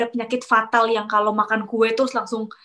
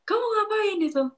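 retold yell; 11 semitones higher than usual. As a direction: imitate the coworker's yell, pitched well up. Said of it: "langsung teriak-teriak gini". (teriakan marah yang ditirukan, nada tinggi)

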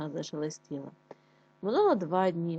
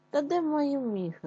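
higher, slower frightened wail; fox wailing in fear; 8 semitones higher than usual. A narrator's reading. Trapped fox wails to the hedgehog, frightened. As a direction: cry out trembling with fear. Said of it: "це кричить лисичка".

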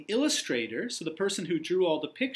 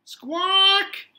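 parrot squawk; 8 dB louder than usual. Acted.

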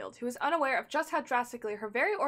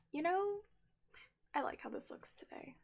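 whispering; 6 semitones higher, 7 dB quieter than usual. Retold or imitated. Voicing own thought while dressing.

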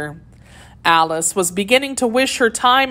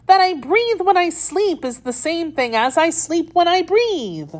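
sleep-talking Dormouse; 12 semitones higher than usual. A narrator's reading. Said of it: "seemed to be walking and talking in its sleep".

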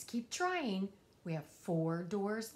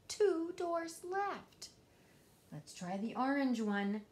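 plain reading voice

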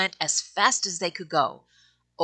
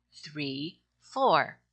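usual speaking voice

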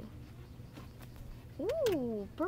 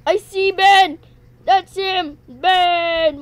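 much louder and higher, a thrilled yell; excited shout; 8 semitones higher, 16 dB louder, slower than usual. Real, not acted. Gamer spotting his friend Ben, thrilled loud shout. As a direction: shout it out like you've found your friend at last.

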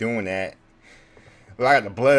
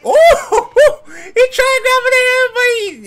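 high, loud laugh through the words; laughing hard; 10 dB louder, slower than usual. Real, not acted. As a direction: crack up while you say it. Laughing at the knocked-out man.

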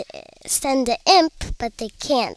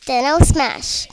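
ordinary delivery

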